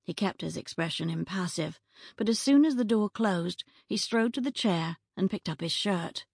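ordinary delivery, nothing marked

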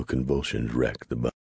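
ordinary delivery, nothing marked

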